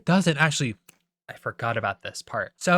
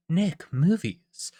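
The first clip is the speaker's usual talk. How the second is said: slow, drawn-out logo voice; mimicked studio jingle; 4 semitones higher, much slower than usual. Vlogger mimics the Nickelodeon intro, drawn-out logo voice. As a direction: imitate a bumper voice, stretching it out.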